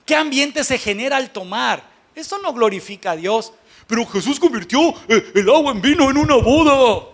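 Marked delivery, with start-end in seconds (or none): 3.9-7.1 goofy mock protest, loud and chuckling; mocking objection. Preacher mimicking churchgoers who defend drinking, mocking. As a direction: parody a silly objector with a laugh in the voice.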